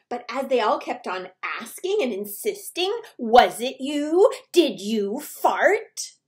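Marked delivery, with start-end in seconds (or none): 3.2-6.2 demanding. Acted. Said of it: "demanding a reply".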